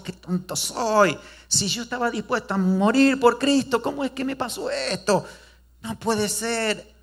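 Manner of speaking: remorseful disciple lamenting his denial; anguished lament. Acted. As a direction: cry out at your own failure. Peter laments after denying Jesus.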